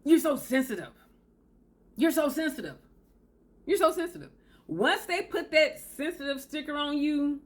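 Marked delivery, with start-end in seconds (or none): 0.0-4.3 mocking, rising with each repeat; dismissive partner brushing off hurt; dismissive narcissist. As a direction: sneer it, climbing higher each time. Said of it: "They're gonna say".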